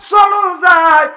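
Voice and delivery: loud, high rallying cry; loud call to go; rallying shout. Acted. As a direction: shout it to urge the friends on.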